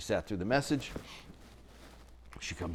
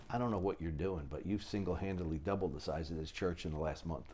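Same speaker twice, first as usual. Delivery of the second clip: hushed pastor voice; 4 semitones lower, 9 dB quieter than usual. Retold or imitated. Pastor quietly praises him aside.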